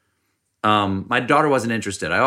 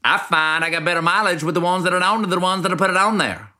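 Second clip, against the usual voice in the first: put-on South Carolina accent; 7 semitones higher than usual. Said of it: "authentic South Carolina accent".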